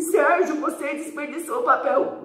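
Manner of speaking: put-on high-pitched outraged kid voice; scandalised child. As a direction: play a shocked pupil scolding.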